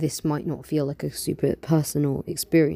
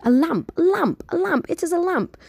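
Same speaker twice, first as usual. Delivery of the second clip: put-on high, thrilled girl's cry; excited; 6 dB louder than usual. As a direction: gasp it out in delight, much higher. Girl finds a magic lamp.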